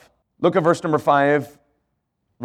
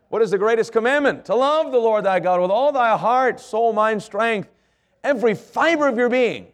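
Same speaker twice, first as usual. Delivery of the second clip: much higher, rising preacher's fervor; fervent preaching; 7 semitones higher than usual. Acted. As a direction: lift it up and preach it with passion. Preaching the greatest commandment.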